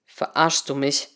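low, slow, earnest question; serious question. A narrator's reading. Henry questioning his colleague, serious low question. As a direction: ask it gravely, without raising the voice. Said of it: "fragte Henry ernst".

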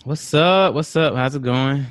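loud, high hype greeting. Real, not acted. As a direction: shout a hyped hello. Greeting on guest podcast.

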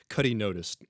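usual speaking voice

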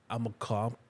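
usual speaking voice

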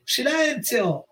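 mock shout. Teacher demanding silence in class, mock shout at restrained volume.

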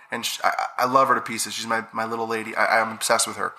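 ordinary talk, nothing marked

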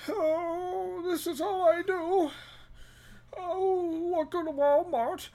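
high, resigned put-on voice; lightly voiced tired greeter; weary greeter. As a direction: sigh out the greeting. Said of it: "door greeter who's all like".

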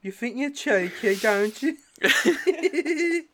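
high, loud put-on Scouse accent, through the nose; nasal Scouse voice. Friend teasing his mate on a call, in nasal Scouse voice. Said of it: "the Scouse accent come out".

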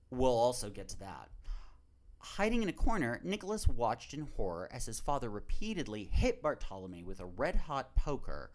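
ordinary delivery

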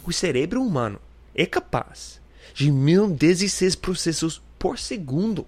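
high, bright fact-reading voice; announcer voice. Acted. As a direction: read it like a trivia announcer. Reads facts in another voice.

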